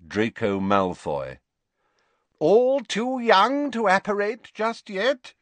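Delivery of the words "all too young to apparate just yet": put-on much higher, slow, jovial old teacher voice; a kindly old professor, unhurried; genial Slughorn. A narrator's reading. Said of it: "said Slughorn genially".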